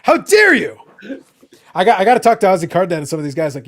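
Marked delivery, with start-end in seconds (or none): 0.0-0.8 higher, louder, hammed-up indignation; over-the-top betrayed fan; mock outrage. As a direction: play it up like a victim of a grand plot. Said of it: "I'm like".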